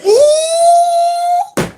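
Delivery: very high, crying-out "Ooh"; high whooping ooh. Real, not acted. Host whoops high at the puppet remark. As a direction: let out a shrill whooping ooh.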